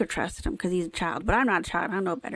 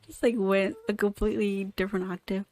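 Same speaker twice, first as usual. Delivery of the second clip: ordinary delivery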